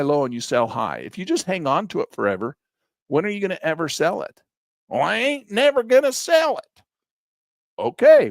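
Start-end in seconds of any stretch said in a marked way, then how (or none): 4.9-6.8 high, hammed-up yokel voice; mock the holder in a silly high voice; squeaky mock hillbilly; mocking stubborn holder. 7.8-8.3 high, loud, incredulous "okay"; exasperated okay; sarcastic okay. Real, not acted. Sarcastic reply to mock holder.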